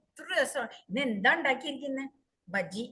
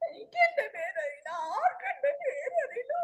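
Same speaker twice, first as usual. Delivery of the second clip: crying; 9 semitones higher, 5 dB louder than usual. Acted. Wife weeps she forgot her name.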